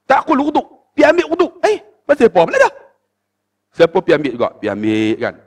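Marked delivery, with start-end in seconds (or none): 0.1-1.5 lightly acted sheikh, high-pitched command; sheikh's order. Acted sheikh ordering him back. 1.7-2.7 high, quick mock indignation; put-upon complaint, acted out; indignant protest. Acted protest at repeated order.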